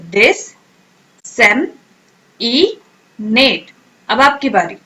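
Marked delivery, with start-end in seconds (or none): 0.1-1.7 डिसेमिनेट drawn out slowly for repeating; slow whole-word model. Shown as a demonstration. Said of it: "अब मेरे साथ pronounce करें".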